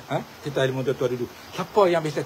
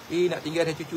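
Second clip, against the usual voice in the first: ordinary delivery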